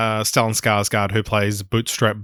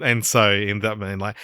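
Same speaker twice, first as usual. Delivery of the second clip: ordinary talk, nothing marked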